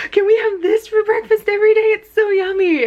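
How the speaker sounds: high and loud, gushing with delight; excited gushing. Retold or imitated. To her partner over greasy pancakes, high delighted gush. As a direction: squeal it like the tastiest thing ever. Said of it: "bit into it and went".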